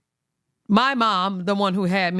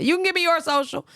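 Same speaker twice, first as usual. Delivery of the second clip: higher, smug retort; cheeky comeback; 11 semitones higher than usual. Retold or imitated. Son firing back at her request, smug and higher. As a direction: turn it back on her, smugly.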